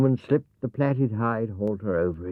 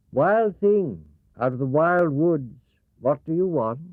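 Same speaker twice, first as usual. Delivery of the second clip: higher, louder call to a wild thing; calling out to the beast at the cave mouth; the woman's call; 8 semitones higher, 4 dB louder than usual. Acted. Woman calls out to Wild Horse, higher and louder.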